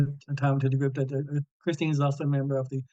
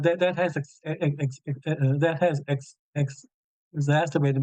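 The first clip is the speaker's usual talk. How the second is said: repeated blocks on the first sound; real stutter caught on ex-. Real, not acted. Stutterer speaking about his stutter.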